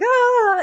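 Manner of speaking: high, loud mock scream; imitated shriek of someone panicking; panicked yelp. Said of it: "People panic".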